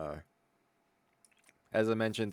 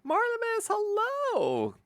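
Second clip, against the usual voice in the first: high squeaky hello; high greeting voice; slower than usual. Acted. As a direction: sing the name out high. Greeting a chatter.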